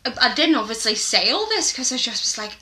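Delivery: normal talking voice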